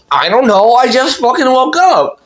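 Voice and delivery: high-pitched, climbing plea; exasperated protest. Retold or imitated. Kid answering mom's accusation, protesting desperately. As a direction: imitate a flustered kid insisting on innocence. Child insists to mom.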